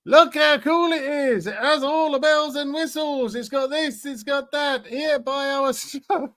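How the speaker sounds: high-pitched mock salesman gushing. Translating a boaster who mocks American military hardware, high mocking voice. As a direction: take the mickey in a silly high voice. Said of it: "taking the mickey out of".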